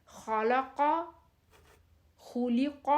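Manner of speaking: خلق with a thick, full-mouthed kh and q; heavy letters. Shown as a demonstration. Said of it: "خا بھی موٹا ہے، قاف بھی موٹا ہے". (خلق کو موٹے حروف کے ساتھ پڑھیں)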